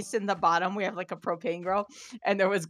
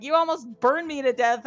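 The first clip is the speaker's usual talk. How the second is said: retold upset; 6 semitones higher, 3 dB louder than usual. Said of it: "He was like".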